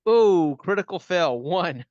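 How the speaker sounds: loud, high cry of dismay; real dice-roll disappointment; dismayed groan. Real, not acted. Player groans at failed dice roll.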